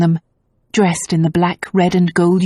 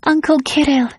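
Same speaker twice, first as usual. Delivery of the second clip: much higher delighted cry; glad cry; 10 semitones higher than usual. A narrator's reading. Tazia greeting her uncle, delighted cry. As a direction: call out to him with joy. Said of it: "with a soft cry".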